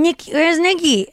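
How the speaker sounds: put-on high, slow little-girl voice; lightly voiced toddler, drawn out and high; toddler niece voice. Toddler niece asking for her aunt, in a high, slow little voice. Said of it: "she kept going".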